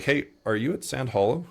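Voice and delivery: mildly acted caller checking in; curious caller. Retold client's phone question.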